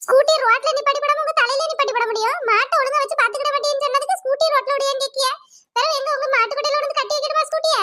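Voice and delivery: a hint of an indignant neighbour complaining; scolding neighbour. Neighbour scolds cow owner over her broken scooter, indignant.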